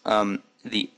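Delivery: ordinary talk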